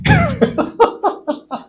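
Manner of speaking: high squealing laugh. Real, not acted. Preacher laughing at his own story, high.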